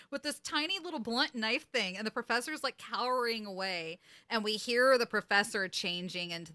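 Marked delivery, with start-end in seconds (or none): none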